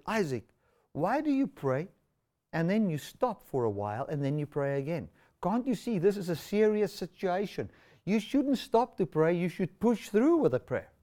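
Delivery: high-pitched, stressed scolding. Retold or imitated. Stressed driver scolding Isaac for stopping his prayer, exasperated. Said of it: "I was stressed out".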